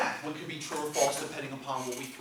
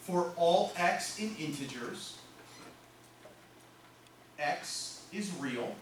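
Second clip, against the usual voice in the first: slow, evenly spaced words; slow dictation; much slower than usual. Shown as a demonstration. Teacher writing the formula for students, slow dictation. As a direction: give them time to write it.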